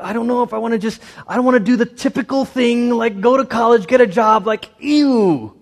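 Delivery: high mocking valley-girl whine; whiny young adult. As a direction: imitate a bored twenty-something. Mocking twenty-somethings' complaint.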